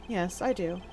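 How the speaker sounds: low, soft, deliberate character voice; hushed, measured deduction; cool, composed investigator. Acted. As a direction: reason it out quietly and formally.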